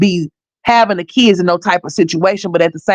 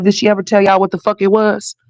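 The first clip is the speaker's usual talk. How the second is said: usual speaking voice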